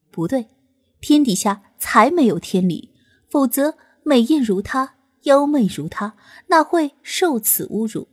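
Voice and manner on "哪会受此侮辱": usual speaking voice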